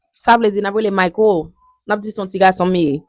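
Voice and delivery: ordinary talk, nothing marked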